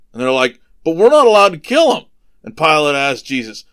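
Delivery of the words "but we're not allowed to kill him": fast, high, loud complaint; hurried whiny-crowd style protest; protesting objection. Retold or imitated. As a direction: act out the accusers objecting to Pilate. Accusers protest to Pilate.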